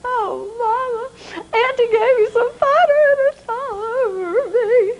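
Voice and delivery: put-on high, tearful little boy whimper; a sorry child close to crying; pitiful boy. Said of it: "He just looks so pitiful".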